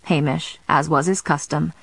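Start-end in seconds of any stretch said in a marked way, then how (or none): none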